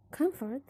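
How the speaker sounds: "comfort" with the first syllable COM stressed. Shown as a demonstration. Teacher pronouncing the stem for the class, COM stressed in "comfort". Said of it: "the stress is in come".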